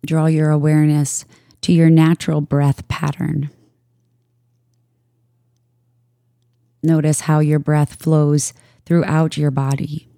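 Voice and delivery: calm, even voice with long pauses between phrases; unhurried yoga nidra guidance; guided meditation. Real, not acted. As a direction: guide listeners into stillness, leaving space after each phrase.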